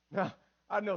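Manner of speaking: usual speaking voice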